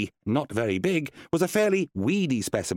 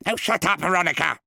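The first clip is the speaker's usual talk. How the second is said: higher, snapping rebuke; sharp; 7 semitones higher, 3 dB louder than usual. A narrator's reading. Wizard silencing his budgie, snapping and raised. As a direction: cut her off sharply. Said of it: "said Randolph sharply".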